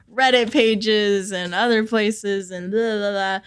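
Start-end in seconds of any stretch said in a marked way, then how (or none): none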